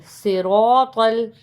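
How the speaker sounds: صراط with heavy, rounded ra and to; full-mouthed R and T in صراط; thick R and T. Shown as a demonstration. Teacher showing students thick ra and to in صراط. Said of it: "تو کو بھی موٹا پڑھیں گے".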